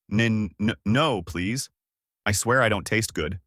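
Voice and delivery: ordinary delivery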